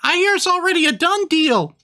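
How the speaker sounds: high squeaky Christmas elf; chirpy elf shopkeeper, way up high. Acted. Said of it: "I do an elf voice".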